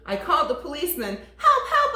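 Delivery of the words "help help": high, loud panicked call; boy shouting help down the phone; frantic cry for help. Acted. Boy calling police for help.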